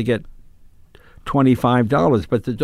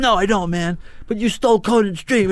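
higher-pitched mock angry yell; accusing, raised voice without full volume; retold screaming; 6 semitones higher than usual. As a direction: imitate an irate driver refusing to talk. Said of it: "he's just screaming".

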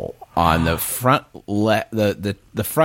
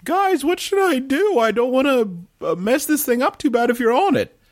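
very high, squeaky character voice; high-pitched in-character plea. Acted. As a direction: pitch it way up, asking the party. Player voices their character to party.

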